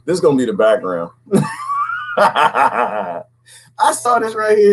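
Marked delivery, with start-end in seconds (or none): 1.3-2.1 very high, squealing laugh; cackling. Real, not acted. Host delighted with the new background, cackling.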